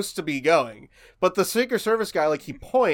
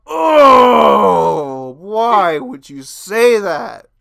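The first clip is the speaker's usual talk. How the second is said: loud, drawn-out mock groan of dismay; appalled crowd; 4 semitones higher, 11 dB louder, much slower than usual. Crowd reacting to Biden's gaffe, loud appalled wail. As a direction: wail it slowly like a horrified audience. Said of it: "everyone in the crowd goes".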